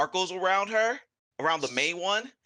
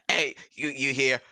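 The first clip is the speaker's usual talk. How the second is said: normal talking voice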